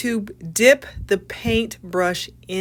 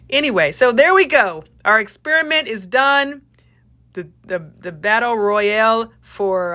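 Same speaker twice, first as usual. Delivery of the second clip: normal talking voice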